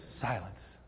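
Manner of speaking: very low, soft command; near-murmured order, deep and calm; hushed command. Acted. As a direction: still the storm with one quiet word. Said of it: "He says".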